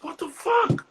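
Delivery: high, strained imitation of the owner; angry club owner. Club owner scolding the comic after the set, high outraged voice. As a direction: squeal it out like an outraged boss.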